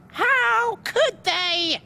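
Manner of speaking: very high, slow screech of outrage. A narrator's reading. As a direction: screech it out, stretched long. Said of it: "she screeched".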